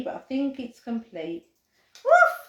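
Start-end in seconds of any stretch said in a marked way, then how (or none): 2.0-2.4 high, loud puppy woof; squeaky excited woof; playful bark. Pretend. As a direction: bark like a happy little dog. Playing the craft dog.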